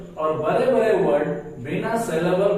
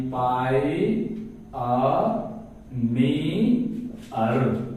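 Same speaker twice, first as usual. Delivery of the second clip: "पाई, आ, नी, अर" lower, with long gaps; syllable by syllable; 3 semitones lower, much slower than usual. Shown as a demonstration. Teacher dividing pioneer into four syllables, one at a time. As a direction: isolate each of "पाई, आ, नी, अर". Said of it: "इसका चार syllable बनेगा".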